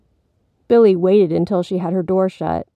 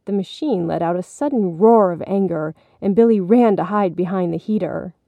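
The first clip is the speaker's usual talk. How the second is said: usual speaking voice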